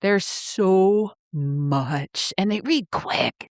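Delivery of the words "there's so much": "so much" slowly drawn out; emphatic gushing. Real, not acted. Co-host urging listeners on, "so much" stretched out emphatically.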